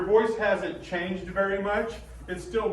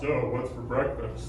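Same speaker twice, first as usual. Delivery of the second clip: mock deep voice; 7 semitones lower, slower than usual. Grown kid next morning, deep.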